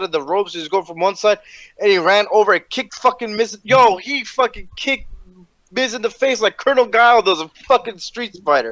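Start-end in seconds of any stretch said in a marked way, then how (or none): none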